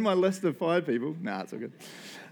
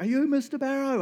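nurse calling out; 10 semitones higher than usual. Retold or imitated. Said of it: "she just yells out across".

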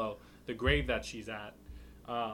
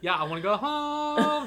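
loud, high-pitched whiny wail; mock whining; 7 dB louder than usual. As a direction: stretch the last word into a hammed-up whine. Acting out captive Shiloh's complaints.

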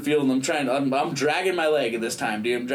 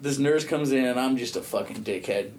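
ordinary talk

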